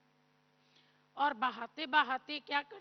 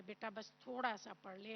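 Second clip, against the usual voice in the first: much softer, gentle coaxing of a son; lovingly coaxing; 4 semitones lower, 10 dB quieter than usual. Retold or imitated. Mother gently coaxing her son to read, softly. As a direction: coax him softly, like handing back his book. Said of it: "माँ प्यार से पकड़ाए".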